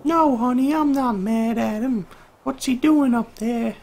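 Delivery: high, slow, stretched-out distant voice; mom calling from afar. Acted. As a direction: answer your kid from across the house in falsetto. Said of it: "that's what her mom sounds like in the distance".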